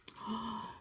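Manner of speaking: a soft surprised gasp. Acted. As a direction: catch your breath in delight. Reacting to news.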